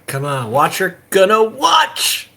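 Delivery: yelling at the dice, drawn out; shouting. Real, not acted. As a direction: call it out, rising to a big slow shout. Urging dice roll, calling out.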